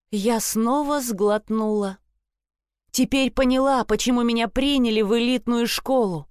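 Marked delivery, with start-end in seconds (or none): none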